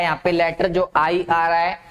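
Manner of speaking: ordinary talk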